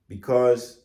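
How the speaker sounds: ordinary delivery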